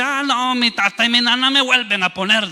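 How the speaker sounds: high-pitched, carefree mock confidence; cocky youth. Son counting on his parents, mock cocky. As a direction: play the youngster sure of being bailed out.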